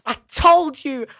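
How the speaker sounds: high, loud, drawn-out I-told-you-so; triumphant. Retold or imitated. Child proved right about grandmother, triumphant. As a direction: crow it at the doubters. Retold triumphant vindication to doubters.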